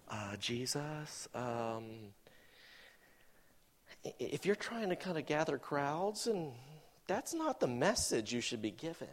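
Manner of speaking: low, hushed and halting; mock-embarrassed, very soft stammer; awkward, hesitant correction. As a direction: timidly raise a doubt, with long pauses.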